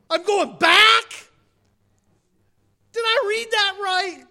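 whooping, high, loud mock disbelief; hammed-up incredulous yelling, as if ordered out of paradise; Lazarus. Preacher playing a man in heaven told to go back, loud comic disbelief. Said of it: "Lazarus is up there".

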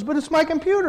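whiny protest. Retold or imitated. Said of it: "He goes".

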